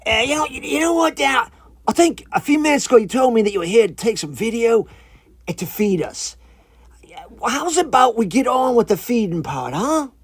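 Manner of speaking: high, loud put-on creature voice; a little iguana struck by an idea; eager iguana. Iguana pushing the visitor toward feeding time, eager high voice. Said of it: "like a light bulb went off".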